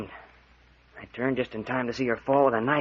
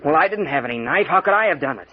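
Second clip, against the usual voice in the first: raised protest; 3 semitones higher, 6 dB louder than usual. Acted. Protests innocence.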